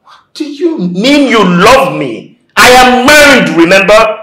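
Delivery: high, very loud indignation; angry outburst. Acted. Married person rejecting a love confession, angrily shouting. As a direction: shout it as an outraged reminder.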